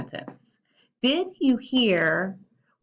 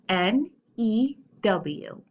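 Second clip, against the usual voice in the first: letters spaced out one by one; spelled out slowly; much slower than usual. Shown as a demonstration. Teacher spelling to kids, slow and clear. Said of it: "spell it with me".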